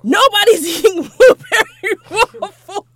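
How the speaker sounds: loud, laughing through the words. Real, not acted. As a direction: crack up while telling it. Laughing while retelling outburst.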